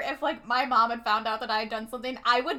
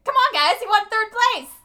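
much higher, louder excited cheer; 10 semitones higher, 7 dB louder than usual. Retold or imitated. Chewy cheers the girls after their parents leave, high excited cheer. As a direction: whoop it like a proud hype man.

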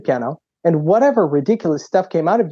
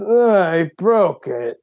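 put-on dazed kid; 3 semitones higher, much slower than usual. Dazed kid voiced in story.

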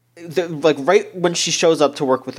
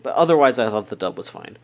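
ordinary talk

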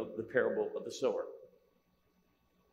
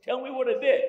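high, loud, coaxing plea; pleading husband; 8 semitones higher, 6 dB louder than usual. Retold or imitated. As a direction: soothe her while begging to know. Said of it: "I said".

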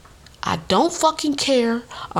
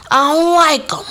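high and louder, firm declaration; 8 semitones higher, 6 dB louder than usual. Real, not acted. Telling the audience her dislike, said higher and louder. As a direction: say it out loud this time, no hiding.

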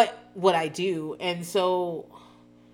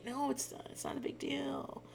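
mock gaslighting; 7 semitones higher, 15 dB quieter than usual. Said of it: "he's gaslighting her".

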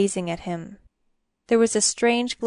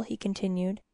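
plain reading voice

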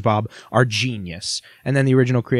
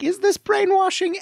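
incredulous. Retold or imitated. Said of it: "you're like".

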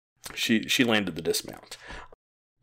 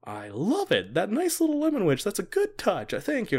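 mock king with a much higher, gushing voice; the king is pleased by the chef's tea, so he gushes high and bright; delighted king; 12 semitones higher than usual.

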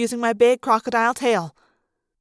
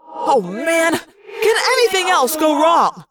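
higher, drawn-out exasperated cry; frustrated yell; 4 semitones higher, 4 dB louder, slower than usual. A narrator's reading. Jake as everything goes wrong, frustrated yell. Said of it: "Jake yelled in frustration".

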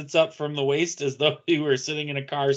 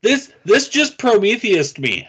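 baffled; 9 semitones higher, 7 dB louder than usual. Real, not acted. Baffled outburst at story end.

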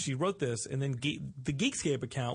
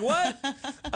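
surprised; 10 semitones higher, 4 dB louder than usual. Retold or imitated. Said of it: "I was like".

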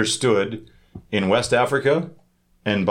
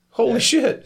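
much higher, astonished exclamation; amazed; 12 semitones higher, slower than usual. Real, not acted. Hearing university is free in France, high amazed outburst. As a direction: burst out in real amazement.

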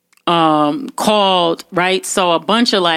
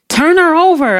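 higher, louder mimicked command; urgent bystander's order; 10 semitones higher, 5 dB louder than usual. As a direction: call it out like a bystander at an accident.

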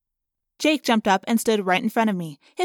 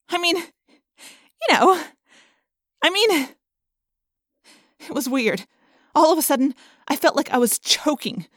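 worked up; 6 semitones higher, 5 dB louder than usual. A narrator's reading. Rachel struggles, getting worked up.

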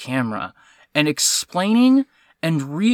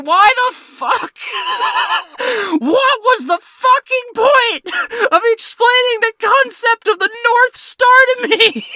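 high, loud shriek of comic outrage; exasperated yelling; 6 dB louder than usual. Real, not acted. Film watcher outraged by the North Star ending, yelling.

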